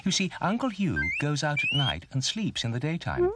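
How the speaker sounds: high, chirpy bird voice; chirping bird. A narrator's reading. Birdie explains owls to Bump, chirping. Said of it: "chirped Birdie".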